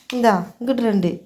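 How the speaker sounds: ordinary delivery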